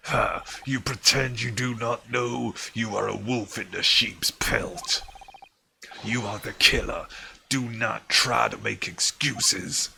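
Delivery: loud gruff guard branding him the killer; gruff accusing guard. Acted. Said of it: "He had, like, a really gruff voice".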